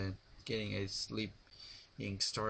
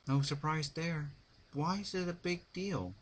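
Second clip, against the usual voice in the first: inner thought; 7 semitones higher than usual. A narrator's reading. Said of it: "she thought".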